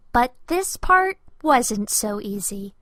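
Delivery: ordinary delivery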